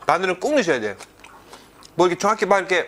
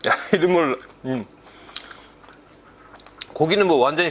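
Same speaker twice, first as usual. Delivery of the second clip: speaking between bites; talking while chewing; 3 semitones lower than usual. Real, not acted. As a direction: mouth partly full of food. Seen 2: Chews with closed, working mouth; takes bites at 1.8 and 14.0.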